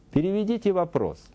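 ordinary talk

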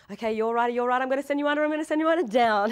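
high, loud urgent chatter, building; imitating a frantic heads-up, climbing in pitch; urgent warning. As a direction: rattle off reassurances, rising to a shout. Imitates co-star ducking her in water.

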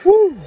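high loud whoop from the heat; burning mouth. Real, not acted. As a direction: gasp it out as the spice hits. Reacting to hot pepper bean.

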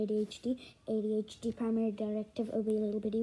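low, quick monotone recital; droning directive. Acted. 80-HD reciting its primary directive, low quick drone. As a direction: drone it like a programmed machine.

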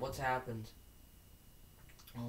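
ordinary talk

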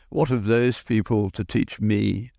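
ordinary delivery

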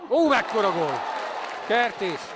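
shouting the goal, thrilled; roaring a stunning goal; goal celebration shout. Real, not acted. Goal call in live commentary.

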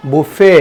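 বুফে as "boo-FAY", loud rising end; correct pronunciation. Shown as a demonstration. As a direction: say বুফে with a silent t. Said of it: "তাহলে এটা সঠিক উচ্চারণ কি".

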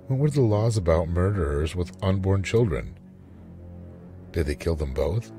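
ordinary delivery, nothing marked

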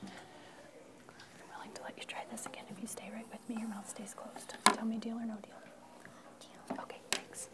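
hushed unvoiced whisper. Real, not acted. Teacher quietly bargaining with a chatty student, whispered. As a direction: keep it just between you two. Private aside to a talking student.

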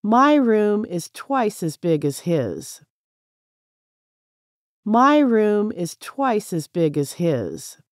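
usual speaking voice

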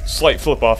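usual speaking voice

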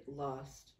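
ordinary talk

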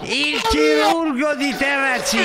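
slow, shouted goal call; breathless italian goal commentary. Pretend. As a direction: belt it like a TV commentator.

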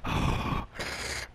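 long unvoiced sigh; steadying sigh before the swing; nervous sigh. Real, not acted. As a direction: let out a shaky breath.

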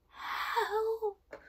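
high, breathy little cry for help; whispered plea. A narrator's reading. Hiding boy pleads for help, in a frightened whisper. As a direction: squeak it out, afraid to be heard. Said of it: "whispered Eddie".

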